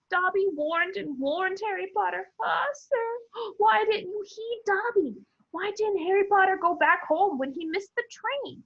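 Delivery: high squeaky elf voice, pleading; house elf. A narrator's reading. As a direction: wail it like a distressed little elf.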